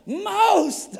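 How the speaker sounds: high, loud shout of mock admiration; awestruck mock exclamation. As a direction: blurt it like an overwhelmed admirer. Retold compliment, word by word.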